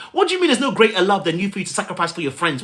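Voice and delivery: ordinary talk, nothing marked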